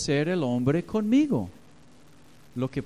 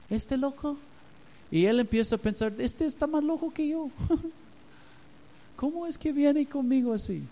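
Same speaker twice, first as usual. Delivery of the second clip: baffled narco; 11 semitones higher than usual. Retold or imitated. Voicing the narco's astonished thoughts.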